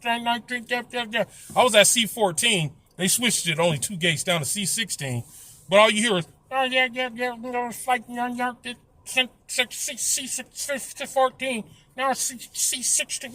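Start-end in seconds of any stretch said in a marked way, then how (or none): 0.0-1.2 high, soft, mumbled announcement voice; mock a gate agent slurring over the intercom; mumbling gate announcer. Mocking airport intercom announcements, mumbled and garbled. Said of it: "mumble jumble sometimes". 6.5-13.3 high, soft, mumbled announcement voice; mock a gate agent slurring over the intercom; mumbling gate announcer. Mocking airport intercom announcements, mumbled and garbled. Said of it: "mumble jumble sometimes".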